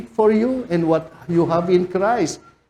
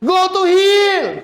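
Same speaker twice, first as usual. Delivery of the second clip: high, loud rebuke yell; pastor shouting; 12 semitones higher, 5 dB louder, slower than usual. Retold or imitated. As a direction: banish Satan with a roar. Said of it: "Ang lakas ng boses niya". (sigaw ng pastor, mataas at malakas na pagpapalayas kay Satanas)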